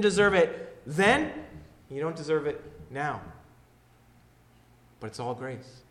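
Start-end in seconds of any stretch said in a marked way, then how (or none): none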